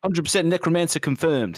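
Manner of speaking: imitating a New Zealand accent. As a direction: quote it in a Kiwi accent. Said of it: "a New Zealand accent".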